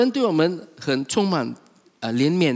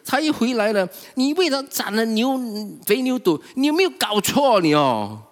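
aggrieved son protesting, raised voice; angry elder son; 5 semitones higher than usual. Retold or imitated. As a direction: vent years of resentment at father. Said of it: "所以他很生气的时候".

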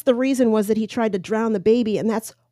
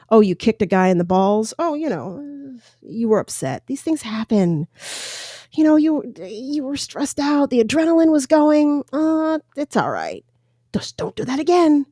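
higher, syrupy, mock-indulgent pardon; sarcastic mock forgiveness; 6 semitones higher than usual. A host mocking a chief who excuses his officers, sarcastic and sugary. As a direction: coo it like letting a child off the hook.

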